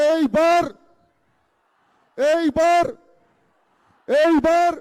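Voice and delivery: loud rising call to the crowd; rallying shout. Real, not acted. (জোরালো স্লোগানের ডাক)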